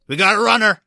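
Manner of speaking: high, loud officer's shout; acted alarm call. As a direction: raise the alarm to the squad. Officer calls out an escape.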